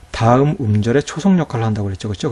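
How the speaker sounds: normal talking voice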